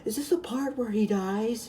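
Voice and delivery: high, loud mock-eager kid; annoying movie spoiler. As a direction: blurt it out like an overexcited pest. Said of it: "He's like".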